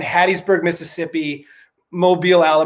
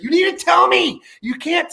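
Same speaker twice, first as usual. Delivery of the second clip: imitating angry friend; 12 semitones higher than usual. Friend scolding him about the surprise, mock angry high yell. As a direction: squeal it like he's furious. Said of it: "Matty was so angry".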